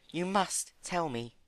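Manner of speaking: high, gentle pony voice; high timid pony. Acted. Fluttershy voicing Rarity.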